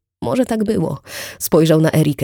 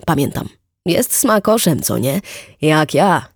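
hearty boastful neighbour; 2 semitones higher, 4 dB louder than usual. Acted. Wit to Erika, bragging about his memory, loud hearty chatter. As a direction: show off his memory with gusto.

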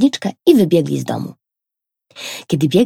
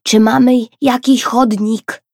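higher, louder repeat; a bit louder; 7 semitones higher, 5 dB louder than usual. A narrator's reading. Kajtek repeats his question to mum, louder. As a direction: say it again so she hears. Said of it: "powtórzył pytanie nieco głośniej".

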